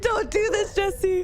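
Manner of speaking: lightly voiced tearful plea; imitating crying self. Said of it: "And I'm like".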